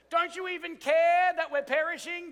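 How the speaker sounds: mock panicked disciple. Disciples accusing Jesus in storm.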